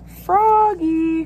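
high, loud two-note call; teacher's long holler; crying out. A narrator's reading. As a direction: stretch the name to get a pupil's attention. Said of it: "cried his teacher".

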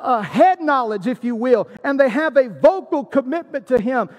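usual speaking voice

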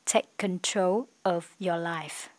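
slow, careful English phrase; slow model reading. Shown as a demonstration. Teacher rereads the phrase slowly to students. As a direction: lay it out word by word. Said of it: "teacher rereads model phrase".

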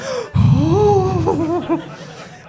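high laughing gasp; laughing gasp of shock; starstruck gasp. Retold or imitated. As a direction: gasp as you spot your hero. Realises it was David Tennant.

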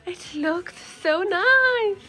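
high, slow gush of delight; excited. Real, not acted. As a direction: swoon over it in delight. Gasps at a skirt.

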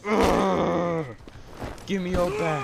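effortful yelling at the screen; strained shout. Real, not acted. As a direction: shout it out under pressure. Struggling in a fight.

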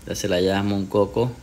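ordinary delivery

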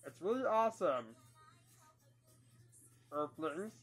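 booming little Martian announcing itself; Marvin the Martian voice. Acted. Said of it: "Duck Dodgers as Marvin the Martian".

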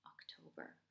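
ordinary delivery, nothing marked

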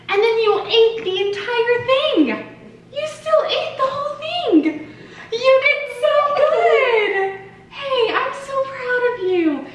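high, slow, loud cheering. Real, not acted. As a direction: celebrate every bite. Praising baby, high voice.

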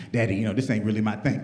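low, soft mopey son; a hint of a sulky teenage son; son's voice. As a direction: mumble it like a reluctant kid.

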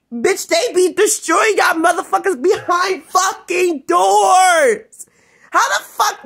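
high-pitched, emphatic rant; angry outburst. Real, not acted. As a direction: build to a shout on the last words. Angry rant, building to a shout.

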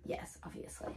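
whispered sarcastic aside; mock agreement. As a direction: breathe it out, deadpan. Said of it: "And I'm like".

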